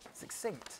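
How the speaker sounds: breathy whisper, single word. Shown as a demonstration. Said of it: "There's a nice succinct".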